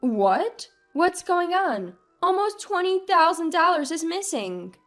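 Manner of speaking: high, alarmed disbelief; stunned, high-pitched outburst; utterly shocked. A narrator's reading. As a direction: let the pitch jump up in shock. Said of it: "was utterly shocked by what I saw".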